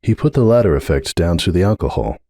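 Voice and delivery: usual speaking voice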